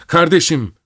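high, desperate cry. A narrator's reading. Sister screams at brother as he attacks her child. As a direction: cry out sharply, pitch leaping up. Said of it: "diye haykırdı".

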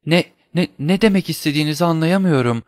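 high, stammering panic; frightened stammer. Acted. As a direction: stumble over the words in terror. Terrified wife stammers denial.

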